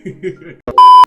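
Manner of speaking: very high squealing burst of laughter; shrieking laugh. Real, not acted. Youtuber cracking up at his own joke, shrieking laugh. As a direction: cackle way up high and loud.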